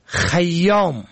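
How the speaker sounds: خیام as "khayyom", slowly enunciated; first vowel fatha. Shown as a demonstration. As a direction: say خیام slowly as khay-YOM. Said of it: "به فتح اول".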